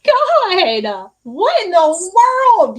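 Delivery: slow, high, loud disbelief; floored by what she's just heard; oh my God, drawn out in shock; stunned gasp. Real, not acted. Shocked by the alien tattoo.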